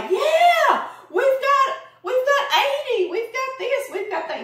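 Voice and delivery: put-on high, loud, eager kid bragging; excited little boy. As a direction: blurt it out like a proud little boy. Little son boasting his catch.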